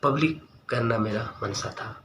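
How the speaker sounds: ordinary delivery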